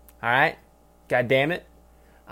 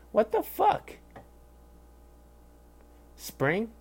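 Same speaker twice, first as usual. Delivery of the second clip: sudden high-pitched bewildered outburst; stuck mid-sentence, baffled at himself; baffled squeak; 9 semitones higher, 5 dB quieter, slower than usual. Real, not acted. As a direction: squeak it out in confusion. Can't find the word.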